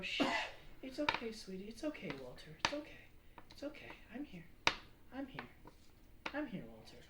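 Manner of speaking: soft hushing lullaby voice; calm the little one down gently; soothing a baby. Acted. Mother hushing baby Walter, soft and soothing. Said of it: "as you hear her go".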